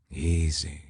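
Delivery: soft, calming easy; soothing. Acted. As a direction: hush her down gently. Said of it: "he soothed".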